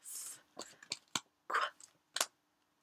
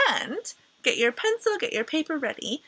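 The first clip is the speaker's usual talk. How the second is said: ordinary delivery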